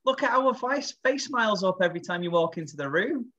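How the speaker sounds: high-pitched, gushing reassurance; hammed-up soothing pep talk; mock reassurance. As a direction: act out a friend consoling him, pitched well up. Voices friends reassuring a doubting dad.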